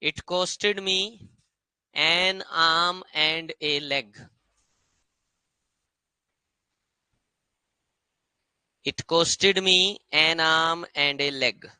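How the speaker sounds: very slow, word by word; teacher dictating a sentence; slow dictation. Shown as a demonstration. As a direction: dictate it so the class can write. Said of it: "लिखना sentence".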